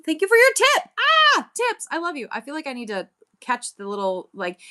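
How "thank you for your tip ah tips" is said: high, loud thrilled thank-you; excited squeal. Real, not acted. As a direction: squeal with delight at the tip. Thanked for a tip on live.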